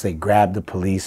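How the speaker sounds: ordinary delivery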